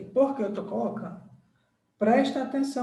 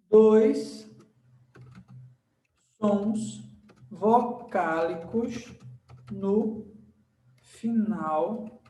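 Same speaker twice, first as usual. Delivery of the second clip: one word at a time, long pauses between; slow dictation; much slower than usual. Shown as a demonstration. Teacher writing a warning about vowel sounds for students, dictating word by word. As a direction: dictate each word as you write it.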